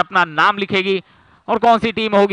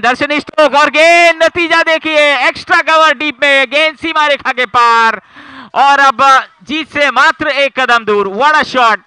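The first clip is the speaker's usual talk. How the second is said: excited cricket commentary; 6 semitones higher, 6 dB louder than usual. Real, not acted. Live play-by-play of winning boundary.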